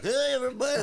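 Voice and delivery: high, loud cartoon greeting; mascot greeting. Giant bowling pin mascot greeting the arena crowd, high and loud. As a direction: boom out a goofy mascot hello, pitched high.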